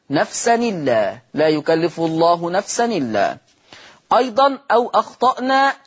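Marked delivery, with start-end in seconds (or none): 0.1-3.4 نفسا إلا linked as nafsanilla; transferred vowel. Shown as a demonstration. Teacher reciting the Warsh transfer for students. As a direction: run نفسا إلا together, nun with kasra. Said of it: "فتصير نون مكسورة".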